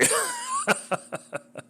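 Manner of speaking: high, breathy string of chuckles; high-pitched wheezy giggle; rueful laugh. Real, not acted. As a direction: laugh helplessly at your own bad luck. Laughs at own bad luck.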